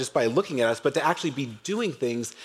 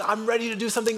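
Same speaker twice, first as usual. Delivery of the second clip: put-on eager young David; 7 semitones higher, faster than usual. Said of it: "He's so passionate".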